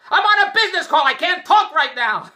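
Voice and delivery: hammed-up shrieking wife; mock yell. Wife shouting she is on a business call, loud mock shriek.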